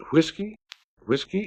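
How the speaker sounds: high rising plea like a bird call. A narrator's reading. Johnny Bear begging the room, a bird-call whiskey. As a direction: call it out thin and hopeful. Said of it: "It was like a bird call".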